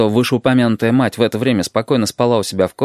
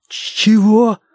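high, indignant sailor voice; appalled, high-pitched question; incredulous; 11 semitones higher than usual. A narrator's reading. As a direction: blurt it out in disbelief. Sailor outraged at alcohol ban.

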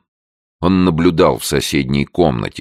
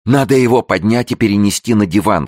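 raised, high, louder command; urgent cry; 9 semitones higher, 5 dB louder than usual. A narrator's reading. Woman orders the men to lift him, crying out. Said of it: "крикнула она".

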